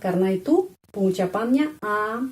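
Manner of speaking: normal talking voice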